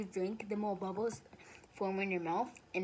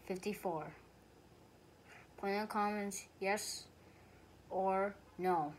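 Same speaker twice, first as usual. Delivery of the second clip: ordinary talk, nothing marked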